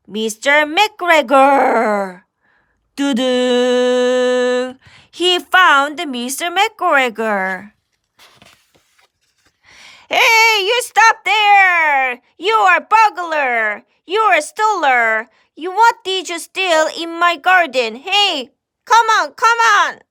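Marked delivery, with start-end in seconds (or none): 3.0-4.8 sing-song little alarm sound; a cheeky toot like a cartoon tune; playful doo-doo sound. Sound effect in story. 10.1-20.0 high, loud angry farmer yelling at a thief; angry farmer shouting. Acted. McGregor voiced chasing Peter.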